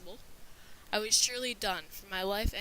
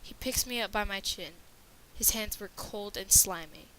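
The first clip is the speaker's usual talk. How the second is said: usual speaking voice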